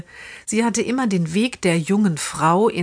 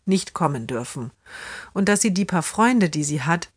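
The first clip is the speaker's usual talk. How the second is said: ordinary delivery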